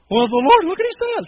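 loud, high flustered outburst; exasperated start. Retold or imitated. Gideon answering the angel, loud and flustered. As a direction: blurt it out in frustration. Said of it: "what's Gideon say?".

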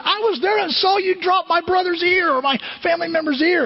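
high, insistent accuser voice; accusing servant. Acted. Servant challenging Peter.

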